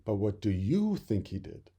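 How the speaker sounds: plain reading voice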